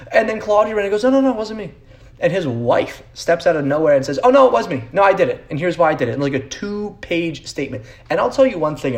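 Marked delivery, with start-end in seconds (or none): 1.0-1.7 higher-pitched quick protest; hurried, high-pitched imitation of denying it; mock denial. Host mimicking player's father denying the leak, mock protest. Acting out Claudio Reyna's denial. 4.2-6.1 higher, louder interrupting voice; mock confession. Host mimicking wife stepping out of nowhere, mock confession.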